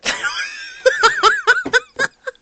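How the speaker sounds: very high, real helpless giggling; high giggle. Real, not acted. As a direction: crack up at the cliffhanger. Laughing at the ending.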